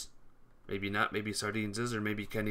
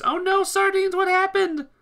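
shrieking very high and loud; anguished shout; 9 dB louder than usual. Real, not acted. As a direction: scream in real dismay. Teammate suddenly eliminated.

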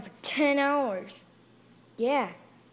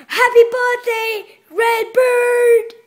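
excited shout; 10 semitones higher, 11 dB louder, slower than usual. Acted. Surprise party greeting shout.

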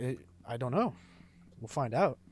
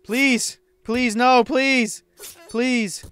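pleading; 10 semitones higher, 9 dB louder, much slower than usual. Real, not acted. Pleading as monster traps them.

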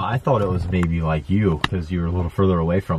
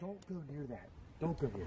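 scared whisper; 5 semitones higher, 22 dB quieter than usual. Real, not acted. Scared whisper at strange noise.

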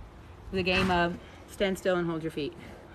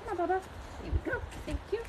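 soft coaxing; 8 semitones higher, 10 dB quieter, much faster than usual. Real, not acted. Coaxing the horse softly.

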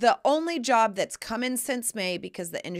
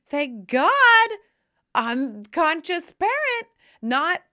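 high, loud, emphatic boast; proud declaration; 5 semitones higher, 6 dB louder than usual. Retold or imitated. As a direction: proclaim it like a victory, much louder and higher. Proudly declaring her parenting style.

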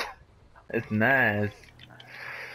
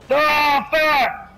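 frustrated shout; 12 semitones higher, 3 dB louder than usual. Real, not acted. Cursing at a crash.

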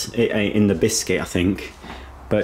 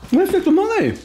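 high calling voice. Real, not acted. Calling for the dog.

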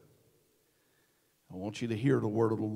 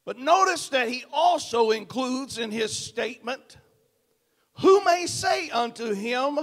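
loud, high, ringing pulpit delivery with hammered words; full-force preaching; 13 semitones higher, 13 dB louder than usual. Acted. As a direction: preach it to the back row, building and pounding. Preaching the sermon body.

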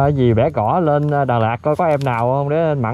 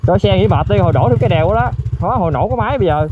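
loud, high call to his friend; 6 semitones higher, 4 dB louder than usual. Real, not acted. Rider calling to his friend to rest the bike, loud call. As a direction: call it across the road so he hears.

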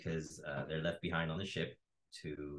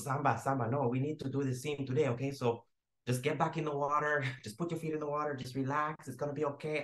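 raised-pitch director urging calm; put-on insistent, reassuring director; Fernando; 8 semitones higher than usual. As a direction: imitate a director coaxing nervous actors back to work. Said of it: "And then Fernando goes".

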